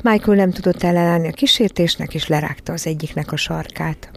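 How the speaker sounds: usual speaking voice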